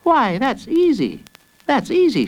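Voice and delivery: put-on high, chirpy little boy; child's voice. A narrator's reading. Jacob answers the villain's riddle, high confident child voice.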